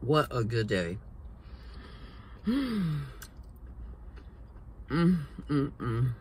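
talking between bites; chewing while talking. Real, not acted. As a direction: mouth partly full. Seen 3: Food bitten at -7; lips pursed, jaw working through the words.

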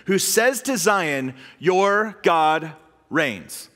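your God reigns drawn out very slowly; slow emphasis. Shown as a demonstration. Preacher stressing key scripture, very slow. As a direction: weigh each word of "Your God reigns.". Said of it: "God's mission statement is that he will reign".

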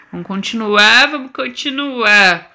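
normal talking voice

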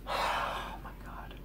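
breathless, drawn-out whisper of disbelief; exasperated whisper. Real, not acted. Teen whispering to himself over his suspension. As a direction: let it out slowly under your breath.

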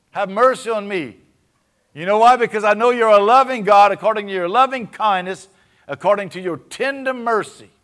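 loud, high begging cry; desperate plea. Acted. David begs God not to judge him, loud high plea. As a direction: cry out for mercy.

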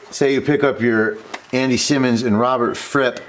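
ordinary talk

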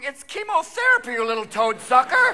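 high-pitched exasperated comeback; indignant retort. Acted. Comedian answering the French accusers of Lance Armstrong, indignant. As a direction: fire back in mock outrage. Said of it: "I'm going".

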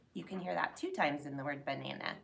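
ordinary talk, nothing marked

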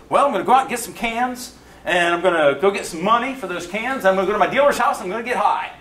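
high, lively listing of plans; upbeat plan-telling. Retold or imitated. Addict telling his plans for the day, high and upbeat.

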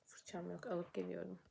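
unvoiced whisper of a small complaint. Real, not acted. Vlogger notices her brush is missing, whispering. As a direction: breathe it out as an aside.

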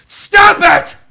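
very high, loud shout; mock outburst. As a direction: explode at the teasing, hammed up. Snaps at teasing chat.

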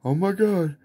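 normal talking voice

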